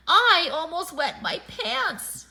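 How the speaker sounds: high, loud, drawn-out outburst; shaken exclamation. A narrator's reading. Buster to friends after the pizza scare, shaken exclamation.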